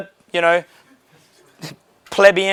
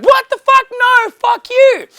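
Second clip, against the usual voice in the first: high, loud, mock outraged yell; outraged shout; 6 dB louder, slower than usual. Mocking a stupid remark with a high, loud outraged yell. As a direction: shriek it out in mock disbelief.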